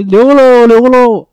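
two loud, high heckling calls; mock heckling shout. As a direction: shout it up at the teacher twice, teasing. Heckling teacher again from seat. (趁老师写板书时高声起哄)

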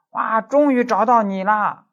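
slow, high, loud call across the distance; calling out from afar. Retold or imitated. Young mother calling out to the law professor, loud and drawn out. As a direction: shout it across the street, drawn out. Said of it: "从那个远处就喊".

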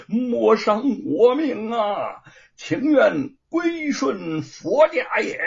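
slow, high, loud pleading; begging for mercy. A narrator's reading. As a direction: plead desperately for your life. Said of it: "只得叫道".

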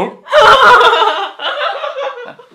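loud, high, long belly laugh; real burst of laughter. Real, not acted. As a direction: crack up completely at your own punchline. 被荒唐酒令逗得大笑. (放声大笑)

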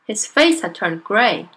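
plain reading voice

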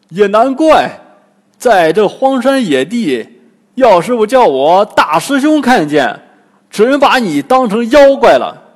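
big, high, blustering character voice; loud, boisterous Bajie. A narrator's reading. Bajie reassures the village girl, loud and boisterous. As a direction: talk very loudly, with hearty bluster.

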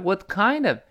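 "what kind of" with KIND punched high; quick weak "what", strong high "kind"; stress on KIND. Shown as a demonstration. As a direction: hit "kind" high and let "of" fall away. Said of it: "这kind一定是重读了".

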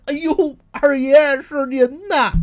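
very high, loud, slow, groveling cry; gasp, then grovel before the master; startled and fawning. Acted. Thug recognizes the master.

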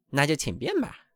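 put-on old duck walking off in a mild huff; offended old duck. A narrator's reading. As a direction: brush her off and leave. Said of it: "老鸭这么说道".

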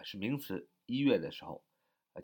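usual speaking voice

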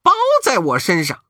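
confident monkey. Acted. Monkey King promises the old man, high and cocky. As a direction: boast with a cocky grin. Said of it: "悟空朝上行了个大礼， 说道".

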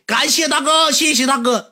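high, rapid-fire gift thanks; mock streamer thanks. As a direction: imitate a livestreamer thanking big tippers in a high voice. Mimics a streamer's cursory thanks.

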